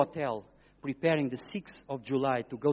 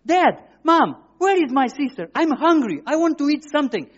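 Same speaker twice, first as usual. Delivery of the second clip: put-on child waking up; 11 semitones higher, 12 dB louder than usual. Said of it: "And he said, like".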